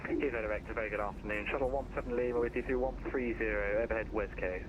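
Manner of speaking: thin radio voice, low level; over a pilot headset. Real, not acted. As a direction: voice coming through a headset radio. Other pilot heard over radio.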